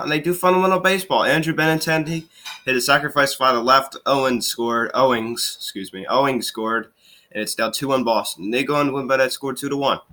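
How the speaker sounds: ordinary talk, nothing marked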